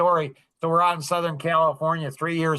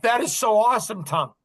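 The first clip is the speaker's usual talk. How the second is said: retold burst of excitement, higher pitched; excited; 6 semitones higher than usual. Telling Tom he has found the answer, excited. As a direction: gush to Tom as the answer lands. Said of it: "I get all excited".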